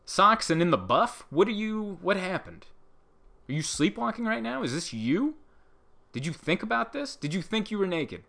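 high, incredulous grilling of a partner; mock disbelief. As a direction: pitch up high and pepper them with baffled questions. Grilling a naked partner, mock-baffled.

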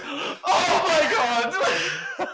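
high laughing mock shriek. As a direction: imitate a friend shrieking through laughter. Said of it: "you turned around and goes".

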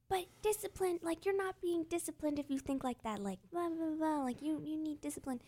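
high, soft whiny mockery; mocking nagging critic. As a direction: whine it like a know-it-all.

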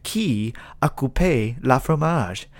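slow, careful mock French accent on "qui a coupé la fromage"; a lesson in a mock French accent, deliberately slow; mock French teacher. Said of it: "trying to teach people, uh, kids French words".

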